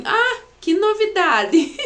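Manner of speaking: high, loud, drawn-out teasing exclamation; mock surprise. Voicing her sister's reply to the message, in high mock surprise. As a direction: stretch it out in playful fake astonishment.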